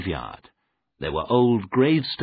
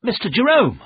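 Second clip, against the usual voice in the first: high, loud alarmed call; urgent raised-voice exclamation; crying out; 13 semitones higher, 7 dB louder than usual. A narrator's reading. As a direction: call his name out in alarm. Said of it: "I cried".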